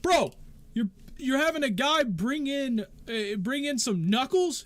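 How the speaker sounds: loud, high, incredulous accusation; heated accusation. Acted. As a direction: press the charge, raising your voice. Heated argument about weapons.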